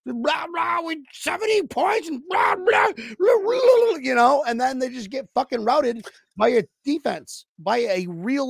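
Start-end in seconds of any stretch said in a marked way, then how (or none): 0.1-4.0 high, garbled mock jabber; mocking trash talk. Mimicking Dolphins fans talking shit all week, high mocking blather. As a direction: imitate loudmouth fans blathering, pitched way up. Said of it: "they're just talking shit the entire, the whole week".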